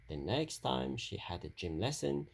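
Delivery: ordinary delivery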